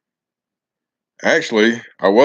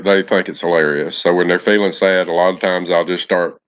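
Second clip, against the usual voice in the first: ordinary talk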